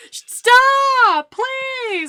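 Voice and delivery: high, loud panicked organ voices; screaming organs. Pretend. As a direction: shriek like dying organs. Organs yelling at him.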